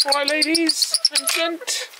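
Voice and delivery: high, slow sing-song greeting; talking to chickens. Real, not acted. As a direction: coo at the hens.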